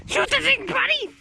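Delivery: high-pitched mock pep talk; cheering on a pet. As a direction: egg the gun on like a puppy. Urging the rifle like a dog.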